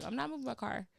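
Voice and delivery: ordinary talk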